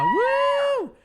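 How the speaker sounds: very high excited "woo"; celebratory squeal; cheering whoop. Real, not acted. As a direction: whoop high for a favorite singer. Cheering for nominated [name].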